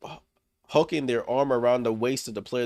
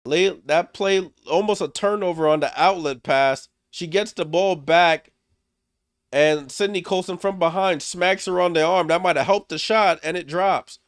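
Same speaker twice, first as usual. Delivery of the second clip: higher, louder, climbing with the play; excited play-by-play; 7 semitones higher, 7 dB louder than usual. Real, not acted. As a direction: call the steal and the and-one like it's huge. Rookie scores and-one.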